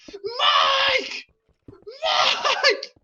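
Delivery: shrill shrieking cry of a name, very high and loud; screaming Mike. Retold or imitated. Karen screaming for her husband at night, shrieking. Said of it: "she screams her husband name".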